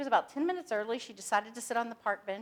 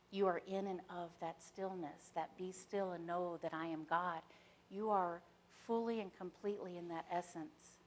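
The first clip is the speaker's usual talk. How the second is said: guided meditation; 3 semitones lower, 7 dB quieter than usual. Acted. Speaker guiding the audience into stillness, meditation voice. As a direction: ease the listeners into stillness, softly.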